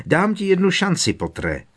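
high loud bellow, falling on Pottere. A narrator's reading. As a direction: roar a last offer. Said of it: "zahulákala Bellatrix". (hulákání)

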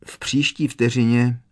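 usual speaking voice

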